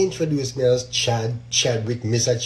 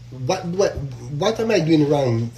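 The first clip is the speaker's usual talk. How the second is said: ordinary talk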